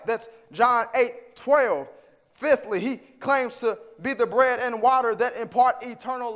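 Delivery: emphatic, rising-energy sermon delivery; preaching. Real, not acted. Preacher proclaims Jesus' claims with scripture, preaching forcefully.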